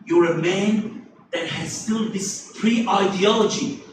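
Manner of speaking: ordinary talk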